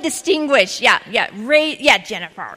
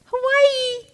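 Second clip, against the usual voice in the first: excited call; 11 semitones higher, 3 dB quieter than usual. Real, not acted. Calls out her own place.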